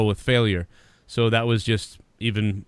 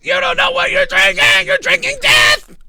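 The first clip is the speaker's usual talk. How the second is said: Sam Kinison; 6 dB louder than usual. Acted. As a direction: shriek the warning in mock horror. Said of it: "He's like Sam Kinison".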